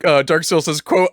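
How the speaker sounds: ordinary delivery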